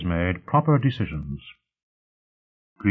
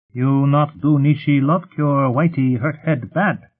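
slow, higher creature voice; threatening creature; 6 semitones higher, 4 dB louder, slower than usual. A narrator's reading. Whitey threatens Ryle with pain, slow creature warning. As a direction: warn word by word, pitched up.

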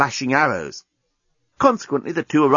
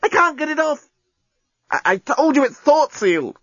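high-pitched flustered protest; frantic exasperation; 12 semitones higher than usual. A narrator's reading. Lovers wrestling with thought-sealed clothing, frantic.

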